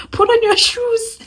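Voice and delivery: high, bossy order; sister-in-law bossily ordering. Retold or imitated. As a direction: order her like a big sister. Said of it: "She's like".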